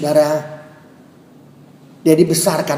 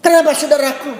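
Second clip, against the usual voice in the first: usual speaking voice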